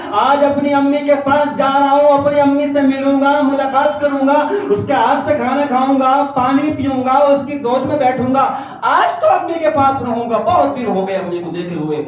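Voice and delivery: put-on high, loud, gleeful boy running home, laughing at the end; joyful boy. Young Bayazid rushing home to his mother, high and joyful. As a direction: bubble over with joy about seeing mother. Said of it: "Bayazid خود کہتے ہیں".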